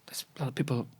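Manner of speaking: quick unvoiced whisper. Girlfriend whispering to him in the bar, hushed. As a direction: lean in and whisper it fast. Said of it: "she was like".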